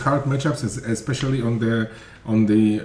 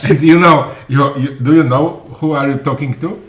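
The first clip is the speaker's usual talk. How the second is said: put-on Russian accent; 2 semitones higher, 9 dB louder than usual. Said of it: "This is my best Russian accent".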